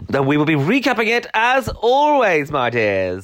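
high, sing-song witch-like sign-off; a hint of the Wicked Witch; witchy voice. Real, not acted. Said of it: "you sounded a bit like the, um, Wicked Witch of the West then".

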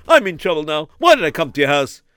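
normal talking voice

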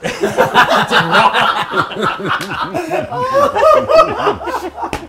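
loud, high, long belly laugh; real burst of laughter. Real, not acted. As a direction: crack up uncontrollably. Big laugh at coconut question.

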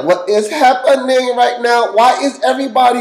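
put-on high, loud, bewildered baby voice; a panicked infant asking what's going on; scared baby voice. Baby at its baptism, voiced high and scared.

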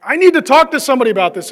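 mock urgent need to gossip; urgent, bursting to talk. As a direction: blurt it out like you can't hold it in.

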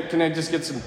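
ordinary talk, nothing marked

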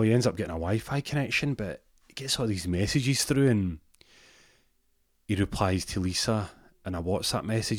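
ordinary talk, nothing marked

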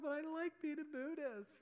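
high, laughing protest, imitating her. Woman insisting she likes Buddhism, laughing.

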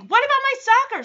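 high, fast mock demand; pleading kid imitation. DJ demanding help from Jesse, high imitated plea.

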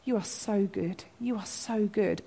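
very soft, hushed and intimate; murmured prayer. Retold or imitated. Preacher repeating simple words to God when prayer won't flow, murmured softly. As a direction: say it softly, over and over, like a private prayer. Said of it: "sometimes I just say the words".

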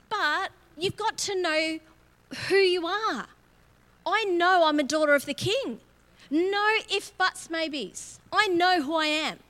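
loud, high preaching proclamation; bold declaration. Real, not acted. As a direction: declare it with full conviction.

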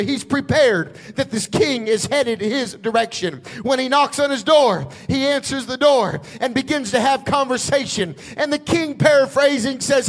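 ordinary talk

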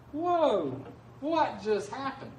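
high whoa, then a slow question; mock amazement. Preacher to congregation, staged astonishment, high. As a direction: sound floored by the turn. Preacher reacts to Elijah's despair.

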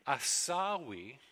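asawi, slow and drawn out; Hebrew word. Shown as a demonstration. Preacher introducing the Hebrew word asawi. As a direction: say asawi slowly, stressing each syllable. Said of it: "The second word there".

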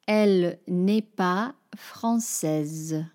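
slow, careful French; French model. Shown as a demonstration. Teacher models example sentences for learners, slow. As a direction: speak it slowly and clearly. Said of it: "other examples".

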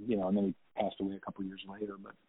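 usual speaking voice

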